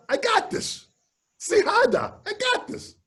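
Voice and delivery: high, excited self-encouragement; psyching himself up in a high voice; high self pep talk. Retold or imitated. Drummer hyping himself up before walking onstage, high and excited. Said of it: "pep talk to himself".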